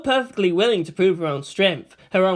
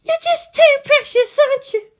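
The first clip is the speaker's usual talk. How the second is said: put-on much higher, tender young woman's voice; sincere mumble; 7 dB louder than usual. A narrator's reading. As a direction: coo it fondly to someone dear. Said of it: "mumble out sincerely".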